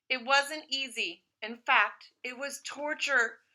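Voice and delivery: usual speaking voice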